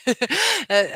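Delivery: tired laugh. Real, not acted. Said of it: "I'm kinda dizzy, tired".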